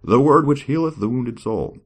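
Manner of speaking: grand, high dramatic reading; scripture reading. Acted. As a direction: declaim it like a preacher.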